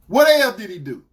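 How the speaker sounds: loud, stern demand; dead serious. Retold or imitated. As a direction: bellow the question at her. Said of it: "dead serious".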